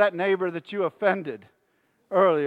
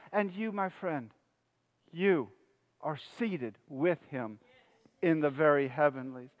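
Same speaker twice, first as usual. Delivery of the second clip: much softer, lower preaching voice; hushed assurance; 6 semitones lower, 10 dB quieter than usual. Real, not acted. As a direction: drop to an intimate hush with pauses. Sudden hush to comfort congregation.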